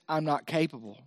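low, soft doubting voice; acted inner doubt, hushed; self-doubt. Voicing a believer's self-doubt.

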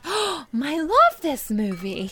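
put-on high, slow childlike gush; little daughter voice. A mother imitating her daughter gushing about a movie in a high childlike voice. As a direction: coo it like an excited little girl.

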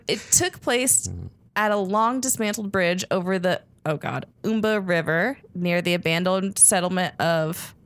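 usual speaking voice

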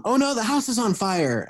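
lightly hammed-up cry of alarm; mock alarm. Improviser calls out a pretend house fire, in mock alarm. Acts out improv offer of danger.

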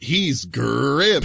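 cartoon mascot catchphrase with a big growled roll; Tony the Tiger. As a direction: draw out the last word like a cereal mascot. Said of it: "Tony the Tiger".

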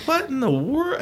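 very high, incredulous squeak; mock bewilderment. Boy watching kids tear down the hill, bewildered.

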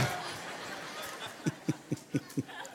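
soft breathy laugh after the joke. Real, not acted. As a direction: let out a quiet laugh.

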